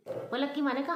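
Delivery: usual speaking voice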